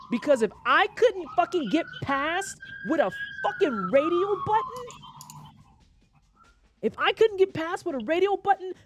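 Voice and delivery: high-pitched, exasperated rant; outraged rant. Real, not acted. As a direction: build indignation as the voice climbs, demanding an answer. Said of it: "why this rant is important".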